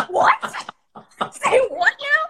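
high, loud incredulous question; stunned disbelief. Retold or imitated. Storyteller reliving the moment the bird died, high loud disbelief. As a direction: ask it like you can't believe your ears.

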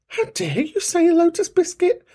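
very high, mock scandalised; mock outrage. Host scolding caller over his biscuit choice, mock outraged. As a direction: act scandalised by his taste.

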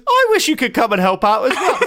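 mock her happy offer; delighted invitation. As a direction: tube woman gleefully inviting the narrator, pitched high. Tube woman invites the narrator.

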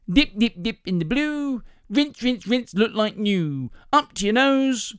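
high, slow sing-song with long endings; salesman's demo patter. Acted. Salesman demonstrating with her knickers, sing-song patter. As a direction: chant it up high, stretching the rhymes.

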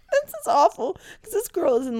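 high, loud, tearful from laughter; laughing. Real, not acted. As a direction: protest while still cracking up. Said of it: "I don't know why I'm laughing".

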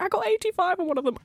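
high-pitched imitation of a friend's news; excited news. Imitating friend announcing an exam mark, high and excited. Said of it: "Because she was like".

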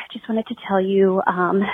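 normal talking voice

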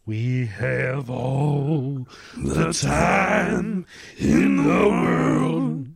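slow, drawn-out movie villain line; bad acting. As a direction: drag it out like a Bond villain. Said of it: "I'm a terrible actor".

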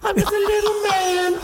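high squealing laugh. Real, not acted. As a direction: laugh the words out. Laughing fit at joke.

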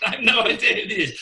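quick bewildered laugh; laughing disbelief. Retold or imitated. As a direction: blurt it out half laughing. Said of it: "And I went".

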